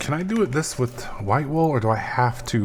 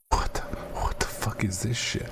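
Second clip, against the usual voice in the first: softer whispered disbelief; 9 dB quieter than usual. Real, not acted. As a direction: whisper in confusion. Baffled by crafting recipe.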